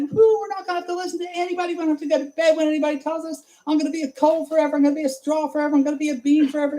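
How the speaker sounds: high, fast, giddy cheering; giddy party voices. Acted. Storyteller voicing the runaways, high and fast with glee. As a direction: whoop it up like kids let loose.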